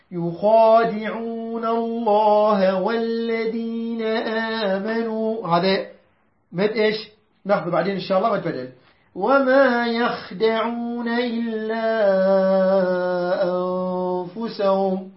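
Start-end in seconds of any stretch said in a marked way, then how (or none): 0.1-5.5 slow measured tajweed chant; Quran recitation. Shown as a demonstration. Teacher recites the verse to the class in slow tajweed. 9.1-15.1 slow measured tajweed chant; Quran recitation. Shown as a demonstration. Teacher recites the verse to the class in slow tajweed.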